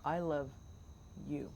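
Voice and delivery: "I love", a pause, then "you" set apart; stress on you. Shown as a demonstration. Host showing where the emphasis goes, stress on "you". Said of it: "where you put the emphasis".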